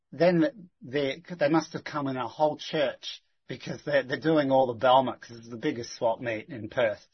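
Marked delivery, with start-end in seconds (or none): none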